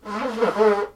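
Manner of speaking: loud, high honk of a nose blow; real goose-like honk. Real, not acted. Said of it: "You guys like the goose?".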